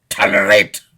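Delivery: sudden explosive shout; real angry roar; outraged bellow. Real, not acted. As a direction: burst out in outrage on the word. Outburst while denouncing child abuse.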